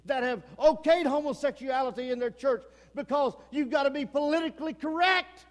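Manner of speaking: loud, driving revival preaching; preaching at full force. Acted. Preacher warning a lukewarm congregation, loud and driving. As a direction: thunder it from the pulpit like a revival preacher.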